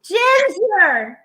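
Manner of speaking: loud, high, happy shout; excited call. Real, not acted. Host greeting Ginger as she comes on, excited shout. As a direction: call out to a friend with delight. Calls out to arriving friend.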